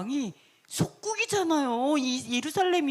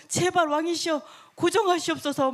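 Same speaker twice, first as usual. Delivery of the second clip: higher-pitched courtly entreaty; urgent pleading; 4 semitones higher than usual. Acted. Subjects begging the raging king to calm down, pleading.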